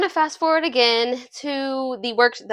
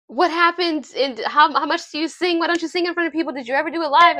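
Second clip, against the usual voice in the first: higher-pitched, eager volley of questions; bombarding questions; 6 semitones higher, faster than usual. Retold or imitated. Nan Young quizzing Jay after his singing, eager bombardment. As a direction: fire questions one on another, excitedly. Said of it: "bombarding him with questions".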